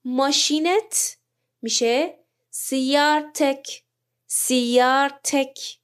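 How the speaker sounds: سیارتک spelled out slowly, "sayyaratak"; slow Arabic demo. Shown as a demonstration. Teacher modelling سیارتک as "sayyaratak" for students, slow. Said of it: "ه رو باید تبدیل به ت کنیم".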